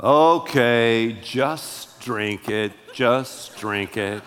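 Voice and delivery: very slow, low, mock-reluctant giving in; grudging consent. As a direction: give in wearily, drawing it out low. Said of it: "And you go".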